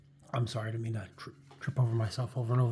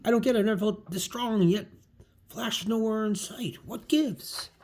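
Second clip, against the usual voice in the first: acted bafflement; 8 semitones higher, 3 dB louder than usual. Spider-Man puzzled by tingling sense.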